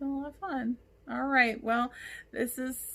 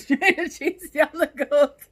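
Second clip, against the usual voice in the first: laughing; 5 semitones higher, 12 dB louder than usual. Real, not acted. Laughing at the idea.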